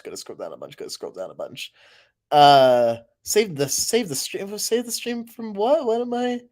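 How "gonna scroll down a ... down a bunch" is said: softer repeated "gonna scroll down a bunch"; fading mutter. Shown as a demonstration. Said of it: "I'm gonna scroll down a bunch".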